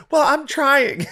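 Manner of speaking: put-on high whiny girlfriend voice; mock girlfriend whine. Girlfriend defending herself about laundry, high whine. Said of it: "she'll be like".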